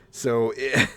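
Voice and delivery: ordinary talk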